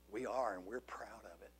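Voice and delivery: whispered, proud admission; a hushed, proud aside. Real, not acted. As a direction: confide it softly with pride. Defiant reply to critics.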